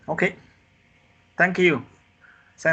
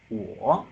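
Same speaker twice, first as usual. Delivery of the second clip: "wo" dipping low, then rising; dip-and-rise "wo"; third tone. Shown as a demonstration. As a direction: drop "wo" down and bring it back up. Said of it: "the third tone is".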